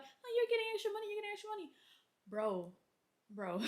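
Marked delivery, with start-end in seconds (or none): 0.0-1.7 high, mocking sing-song voice; mocking people. Mocking coworkers' voice, high and teasing. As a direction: tease like the coworkers who envy the extra pay. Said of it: "people will be like".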